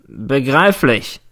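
slow, smug grin in the voice; drawn-out grinning reply, a touch of contempt; grinning prosecutor. Acted. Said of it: "grinst der Staatsanwalt". (langsam und grinsend gesprochen)

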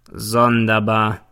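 very slow, drawn-out grinning remark. A narrator's reading. Prosecutor comments on the testimony, grinning. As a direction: stretch it out with a slight grin. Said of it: "grinst der Staatsanwalt".

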